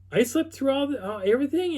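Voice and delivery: very high, disbelieving pitch; astonished question. Retold or imitated. Friend learning he missed the night's events, astonished question. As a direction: imitate a friend's stunned realization. Retold disbelief at sleeping through it.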